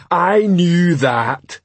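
slow, high-pitched troll comeback; troll insisting. Acted. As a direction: insist it, stretching every word.

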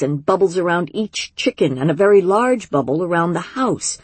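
usual speaking voice

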